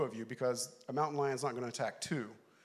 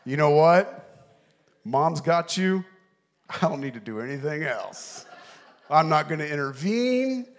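mock lecture to son; 9 dB louder than usual. Preacher scolding the son in the story, loud. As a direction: boom it at the absent kid.

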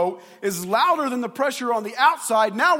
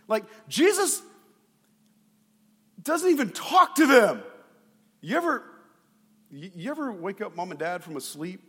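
normal talking voice

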